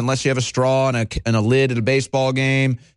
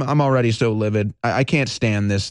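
ordinary delivery

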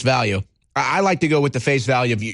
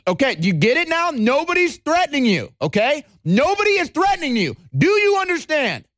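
higher-pitched, exasperated insistence; mock menacing enforcer; 10 semitones higher than usual. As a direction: drill it in like a fake-patient bully.

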